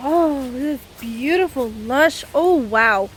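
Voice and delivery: slow, drawn-out wonder at the view; real awe, letting each word hang; awed. Real, not acted. Admiring the lush forest.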